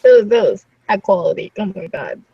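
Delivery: usual speaking voice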